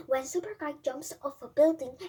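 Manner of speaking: ordinary delivery, nothing marked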